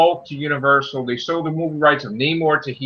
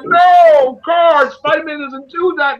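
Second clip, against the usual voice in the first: exasperated protest; 11 semitones higher than usual. Retold or imitated. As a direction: protest like an outraged fan. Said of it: "I'm like".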